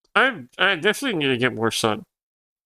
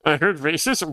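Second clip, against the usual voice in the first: ordinary delivery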